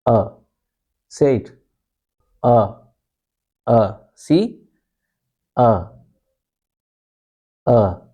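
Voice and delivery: "Uh" as a very short, relaxed sound; a brief, loose "Uh", each one on its own; relaxed schwa. Shown as a demonstration. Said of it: "very relaxed, very short sound".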